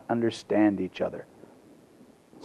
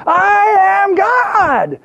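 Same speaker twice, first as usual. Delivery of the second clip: all-out high, long mock shout; hammed-up yell, stretched as far as it goes; Shirley MacLaine shouting; 5 dB louder, much slower than usual. Actress giving guru her loudest try, mock shout. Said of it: "Say it louder".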